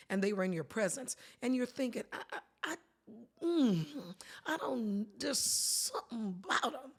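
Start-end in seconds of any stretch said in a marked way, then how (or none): 2.1-6.9 uneasy hesitation. Acted. Thinking about someone who walked in, hesitant and uneasy. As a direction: stammer into a doubtful hum, then halting words. Acting out an uneasy gut feeling.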